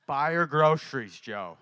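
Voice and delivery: loud insistent order, dropping on the name; retold firm command. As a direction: imitate an insistent divine order. Retold Lord repeats the command.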